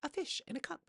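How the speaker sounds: put-on high wide-eyed kid voice; childlike wonder. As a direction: marvel like a small child at a fish. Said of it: "This person's like".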